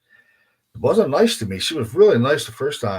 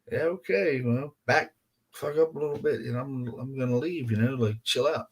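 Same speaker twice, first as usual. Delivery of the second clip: higher-pitched, backing-off reply; placating; 4 semitones higher, 6 dB quieter than usual. Retold or imitated. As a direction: raise the pitch and ease away from trouble. Backing off from the hostile woman.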